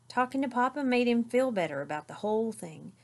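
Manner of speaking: plain reading voice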